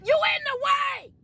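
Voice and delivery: high, drawn-out shout; emphatic exhortation. Real, not acted. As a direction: cry it out as the climax of the point. Climax of exhorting the listeners.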